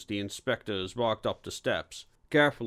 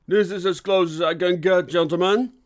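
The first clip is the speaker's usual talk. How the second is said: raised, louder coach driver's voice; driver announcing; 5 semitones higher, 6 dB louder than usual. A narrator's reading. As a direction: call it out to the passengers, higher and louder. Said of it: "as the driver announced".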